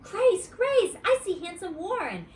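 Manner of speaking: loud high calling of Grace, Grace; thrilled. A narrator's reading. Junie B spotting Warren from the bus window, thrilled and loud. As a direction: squeal the news at your friend. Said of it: "clapped my hands real thrilled".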